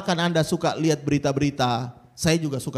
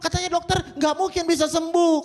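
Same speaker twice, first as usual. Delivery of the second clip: despairing believer; 10 semitones higher than usual. Retold or imitated. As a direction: cry out that it cannot be. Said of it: "Kalau Anda mungkin berkata".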